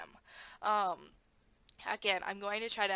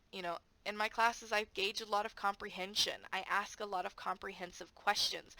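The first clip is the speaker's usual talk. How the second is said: ordinary delivery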